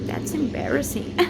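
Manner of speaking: high giggle after owning the mistake; embarrassed laugh. Real, not acted. Vlogger laughs at her language slip, sheepish giggle.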